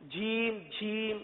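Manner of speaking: جھیم with an aspirated jh, quiet; wrong jheem. Shown as a demonstration. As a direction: show the mistake, جھیم with a puff of breath. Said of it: "اس کو جھیم پڑھتے ہیں". (غلط ادائیگی: جھیم)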